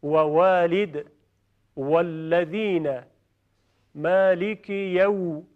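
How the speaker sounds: slow, every «و» rounded as w; slow recitation drill. Shown as a demonstration. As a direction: spell out «والد» with a rounded w.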